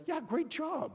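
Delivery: very high, encouraging voice; high-pitched praise. Retold or imitated. Jesus answers after he tried his best, high-pitched praise. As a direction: cheer them on in a lifted, high voice.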